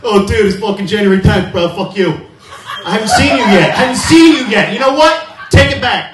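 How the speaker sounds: high, loud laughing mock bro voice; hammed-up bro rant, laughing. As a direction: play the indignant bro who won't let it go. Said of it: "people try to put a rule".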